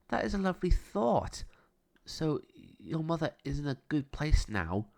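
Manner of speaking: put-on young girl asking gently; Nina's voice. A narrator's reading. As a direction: Nina asking Jack about heaven. Nina's voice, higher.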